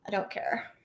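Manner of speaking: breathy whispered I don't care; whispering it to herself. Real, not acted. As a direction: let it drop to a whisper. Trailing repeat of I don't care.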